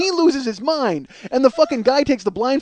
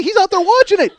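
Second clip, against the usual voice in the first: normal talking voice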